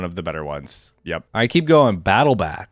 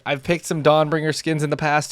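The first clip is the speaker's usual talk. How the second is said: usual speaking voice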